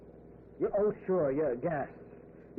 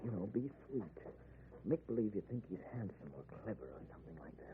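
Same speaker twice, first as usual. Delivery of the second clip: much softer, lower murmur; hushed; 8 semitones lower, 11 dB quieter than usual. Acted. Len coaxing his wife to play along, in a hushed voice. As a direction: keep it low, coaxing. Hushed plotting, killer nearby.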